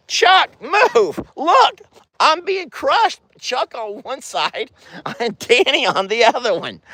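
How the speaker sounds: very high-pitched squeal of alarm. Real, not acted. Owner crushed between two animals, alarmed. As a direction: plead in a high voice while getting squashed.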